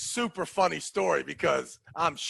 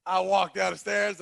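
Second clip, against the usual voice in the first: normal talking voice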